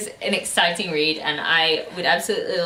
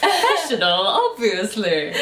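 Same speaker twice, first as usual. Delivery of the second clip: laughing; 9 semitones higher than usual. Real, not acted. Giggling through the word.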